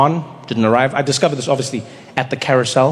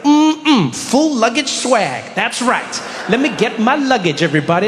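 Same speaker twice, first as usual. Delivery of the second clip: higher, boastful show-off voice; cocky swagger; 7 semitones higher than usual. Retold or imitated. Comedian at the carousel boasting to other travellers, cocky swagger. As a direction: strut it like you own the airport. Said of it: "I was like".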